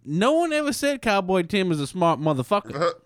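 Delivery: cowboy voice. Pretend. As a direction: boast like a dumb cowboy. Playing Cowboy Tim.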